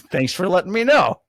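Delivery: put-on aggrieved gratitude; mock thanks. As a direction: imitate your indignant comeback. Retold reaction to being dropped.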